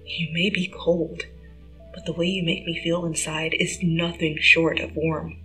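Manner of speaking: soft, tender; hushed tender whisper; softly. Acted. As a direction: reassure her gently. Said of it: "Peter smiled softly".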